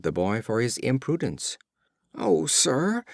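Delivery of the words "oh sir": cried out. A narrator's reading. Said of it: "cried the youth".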